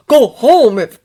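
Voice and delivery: loud angry shout. Acted. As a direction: bark it out.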